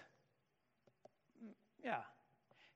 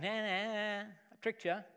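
teasing sing-song nah nah; a teasing schoolyard jeer; mock taunt; 3 semitones higher, slower than usual. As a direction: playfully taunt the crowd. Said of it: "I'm gonna dangle this carrot".

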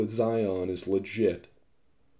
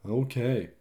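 ordinary delivery

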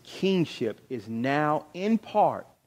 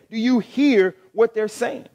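louder and higher, "hear" pushed up into an indignant rising question; indignant priests challenging Jesus, louder; 6 semitones higher, 6 dB louder than usual. Shown as a demonstration. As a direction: play the offended priests. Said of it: "They're upset".

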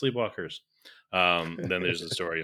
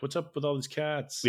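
put-on higher quizzical girl voice; puzzled heroine voice; 7 semitones higher than usual. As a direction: ask it bewildered.

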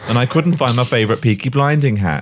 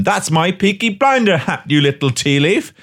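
mock shout; 8 semitones higher than usual. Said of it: "I shout to him".

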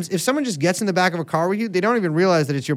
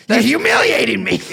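angry Boston husband; 4 semitones higher, 4 dB louder than usual. Acted. Acted quarrel with drunk wife.

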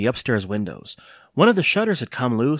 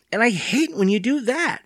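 put-on very high-pitched indignant boy; crying out; 12 semitones higher than usual. A narrator's reading. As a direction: protest like an upset child, voice shooting up. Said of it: "he cried".

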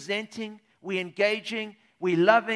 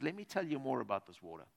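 soft quoted Jesus; 8 semitones lower, 9 dB quieter than usual. Acted. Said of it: "he says".

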